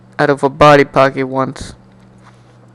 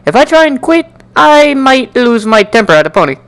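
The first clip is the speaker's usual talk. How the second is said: fretful worry; 8 semitones higher than usual. Acted. Fretting inner thoughts.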